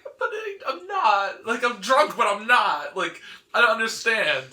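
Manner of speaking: put-on high, loud, bewildered Iron Man protesting he isn't drunk; upset Iron Man. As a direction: plead your innocence in a high, loud voice.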